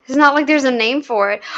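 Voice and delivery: usual speaking voice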